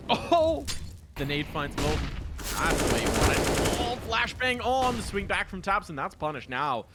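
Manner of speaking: high, loud bursts of excited commentary; live esports play-by-play. Real, not acted. Caster calls a flashbang push for viewers, excited play-by-play. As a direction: ride the action, leaping up at each big hit.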